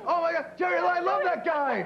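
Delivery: excited. Acted. Thrilled to spot a celebrity.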